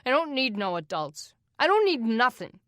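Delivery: rising to a furious shout; snapping back at the adults, building to a yell; angry boy. Acted. Angry orphan boy refusing help.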